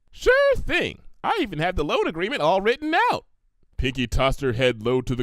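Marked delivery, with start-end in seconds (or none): 0.1-3.3 high, bubbly pony voice; Pinkie Pie voice. Acted. Pinkie offering Applejack the money, high and eager. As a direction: chirp it out eager and helpful.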